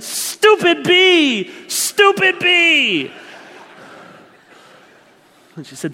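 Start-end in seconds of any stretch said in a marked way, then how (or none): 0.0-3.1 much higher, louder, slow furious stomping cry; angry mama. Retold or imitated. Wife stomping the bee in front of the kids, furious yell. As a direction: stamp out the bee with each word. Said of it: "scared and I was so angry".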